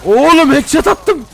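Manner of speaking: high, loud, slow outraged yell; exasperated shout. Real, not acted. Streamer yells at the game after another wasted headshot, loud and outraged. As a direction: belt it out as if the game cheated you. Headshot failed to kill again.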